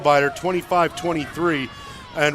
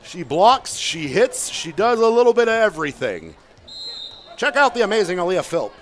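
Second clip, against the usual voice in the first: high, loud circus barker; ringmaster; 5 semitones higher, 3 dB louder than usual. Acted. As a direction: hype her up like the big top. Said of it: "My best circus voice there".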